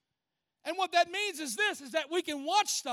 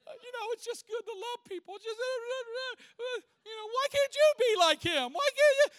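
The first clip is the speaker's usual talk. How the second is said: a mock-tearful wife gushing and scolding; weepy wife; 8 semitones higher than usual. Wife gushing, moved by movie.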